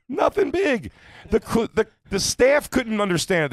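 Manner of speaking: high, slow, squeaky incredulity; mock squeal. Mock squeal about the hotel.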